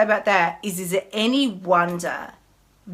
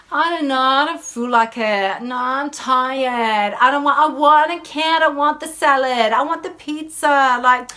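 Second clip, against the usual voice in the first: put-on high, louder whining child; whiny voice; 5 semitones higher, 4 dB louder than usual. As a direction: whine like a sulky kid. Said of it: "Hear my whiny voice".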